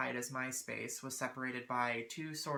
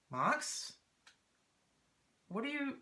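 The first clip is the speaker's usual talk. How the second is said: puzzled calling; 11 semitones higher than usual. Retold or imitated. Hears meowing, confused search.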